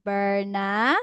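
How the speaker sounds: put-on slow, clear model line for a child; slowly, so the child can copy; slow model sentence. Shown as a demonstration. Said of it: "For example".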